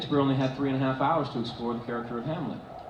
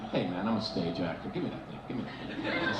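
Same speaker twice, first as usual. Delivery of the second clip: understated stage actor; 6 semitones lower, 9 dB quieter than usual. Retold or imitated. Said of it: "And I'm like".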